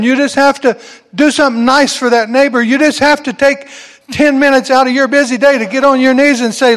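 climbing, urgent preacher call; rising preacher fervor. Real, not acted. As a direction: push the congregation harder with each line.

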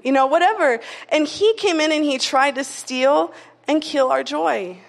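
ordinary talk

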